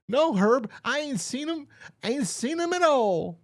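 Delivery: high, hammed-up old-timer squeak; old man voice. The old man, reading his reply to the cop in a high hammy voice. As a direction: play the old man way up in pitch. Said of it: "He goes".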